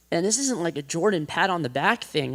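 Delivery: normal talking voice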